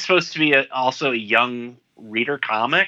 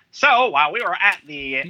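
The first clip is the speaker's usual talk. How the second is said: put-on high-pitched old-time radio announcer; 1940s radio voice; 7 semitones higher than usual. Shown as a demonstration. Podcast host trying out a 1940s radio voice, announcer-style. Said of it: "in my nineteen forties radio voice".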